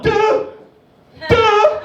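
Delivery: high sung scare-chord "duh"; mock horror sting. Imitating the horror film's scare sound, high. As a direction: belt the movie jump-scare note.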